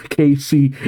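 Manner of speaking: plain reading voice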